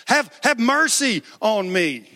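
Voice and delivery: high, loud pleading shout. Acted. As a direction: cry out, easing into a slow plea. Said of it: "But he shouted even more".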